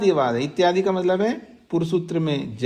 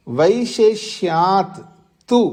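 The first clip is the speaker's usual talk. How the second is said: sutra recitation. Shown as a demonstration.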